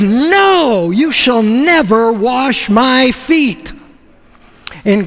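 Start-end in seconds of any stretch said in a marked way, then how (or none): 0.0-3.7 Peter recoils, refusing outright; freaked-out refusal. Retold or imitated. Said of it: "He freaks out".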